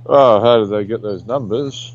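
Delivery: usual speaking voice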